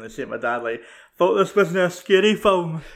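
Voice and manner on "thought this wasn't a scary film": put-on high, slow, loud scared-child cry; scared kid's yell. Telling his mate how he yelled to his dad, scared shriek. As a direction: re-enact the terrified kid shouting at his dad. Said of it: "I fucking screamed the fucking house down".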